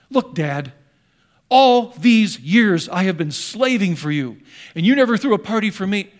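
loud, aggrieved complaint, leaning on each word; indignant, angry son. Acted. Older son protests to his dad, indignant and ticked. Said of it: "Indignant, angry. Man, he's ticked".

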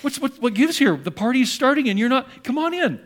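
high, puzzled dad coaxing his son; pleading father. Acted. Dad calls older son in to the party, high coaxing. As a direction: urge him inside, pitched well up. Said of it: "when dad goes out and he goes".

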